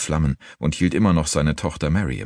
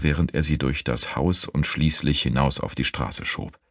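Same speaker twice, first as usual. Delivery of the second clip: ordinary delivery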